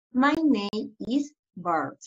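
very slow, drawn-out "My name is Bart."; slow model answer. Shown as a demonstration. Teacher modeling Bart's answer for pupils, very slow. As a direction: stretch "My name is Bart." out word by word. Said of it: "So the answer is".

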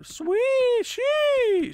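very high, loud squeal of surprise; thrilled. Real, not acted. Player who did not expect that, thrilled high squeal.

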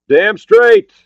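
very high, loud answer; emphatic shout. Acted. Ryan accepting the medics at once, shouting. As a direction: fire it straight back at full shout. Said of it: "Ryan replied at once".

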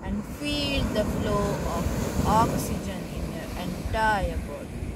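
soft, unhurried meditation voice; guided meditation. Acted. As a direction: gently guide them through deep breathing.

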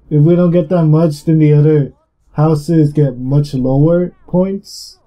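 ordinary talk, nothing marked

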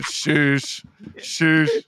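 Shoosh said as shoosh, teasing; mocking mispronunciation. Teasing a friend's garbled Josh, mocking Shoosh. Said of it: "You said".

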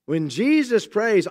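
ordinary talk, nothing marked

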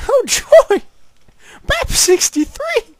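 squeaky very high falsetto; shrill slow cartoon sidekick. High character voice in game.

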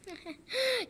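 quiet scoffing laugh; soft laugh. Acted. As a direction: laugh it off softly. Laughs at flying request.

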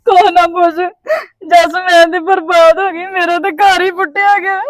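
tearful wail of ruin; crying. Acted. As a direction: sob through the words in acted despair. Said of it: "ਰੋਣ ਕਿਉਂ ਡਏ ਹੋ".

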